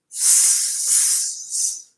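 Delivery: soft, long voiceless "s" hiss; hissing S breath. Shown as a demonstration. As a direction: let the air out on a steady "s". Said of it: "And the S".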